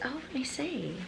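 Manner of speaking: normal talking voice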